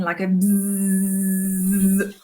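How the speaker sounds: long droning bzzz like a fly; fly buzz. Shown as a demonstration. Teacher buzzing like a fly for the class. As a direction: buzz it out like a fly stuck in a window. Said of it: "That is my best fly".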